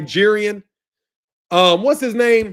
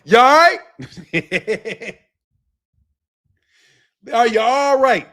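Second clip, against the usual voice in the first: mock concern. Mock check on listener, loud.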